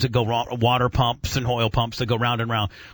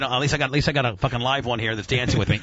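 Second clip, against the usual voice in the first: ordinary talk, nothing marked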